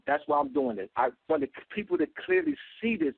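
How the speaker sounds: ordinary talk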